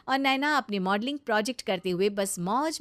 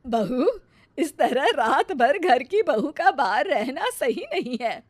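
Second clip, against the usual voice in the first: loud, higher-pitched disapproving reproach; scolding mother-in-law; 5 semitones higher, 6 dB louder than usual. Acted. Mother-in-law objects to bahu staying out all night, scolding. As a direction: scold the daughter-in-law firmly.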